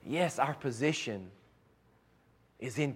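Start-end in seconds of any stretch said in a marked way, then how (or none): none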